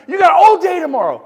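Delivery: loud exasperated push at the agents; emphatic shout. Real, not acted. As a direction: hit it hard and loud. Pushing agents to act fast.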